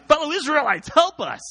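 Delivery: high-pitched cry to the crowd; shouting. A narrator's reading. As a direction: call out high, rallying the crowd.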